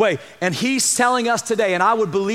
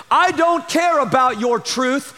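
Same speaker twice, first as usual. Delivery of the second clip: slow, higher-pitched mock defiance; acted dismissiveness; 5 semitones higher, 3 dB louder than usual. Preacher as Pilate scorning truth, mildly dismissive. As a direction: lightly play a ruler brushing truth aside.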